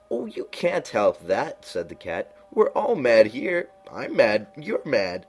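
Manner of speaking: high, slow, drawn-out cat voice; lofty knowing cat, high and slow; Cheshire Cat voice. A narrator's reading. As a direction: purr it out like a sly grinning cat.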